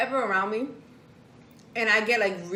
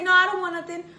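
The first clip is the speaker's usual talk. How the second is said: mock husband; 8 semitones higher, 5 dB louder than usual. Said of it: "And he'll say".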